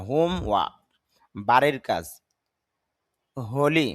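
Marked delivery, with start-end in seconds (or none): 0.1-0.7 হোমওয়ার্ক said with no র sound at the end. Shown as a demonstration. Teacher correcting the r in হোমওয়ার্ক for students. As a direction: drop the r in হোমওয়ার্ক, ending in an open ক. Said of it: "র sound হবে না".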